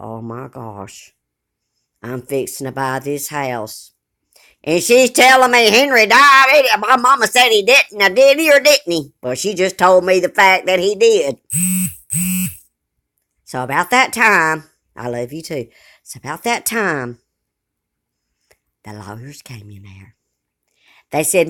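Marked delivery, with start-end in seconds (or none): 0.0-3.9 shocked inner thought. Retold or imitated. Recalls her shocked thought. 18.8-20.1 a sudden whispered aside. Real, not acted. Drops to a whisper in story.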